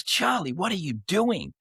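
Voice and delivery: high, alarmed cry of the name; shocked call to brother. Retold or imitated. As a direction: brother running out in a towel, stunned. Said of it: "He goes".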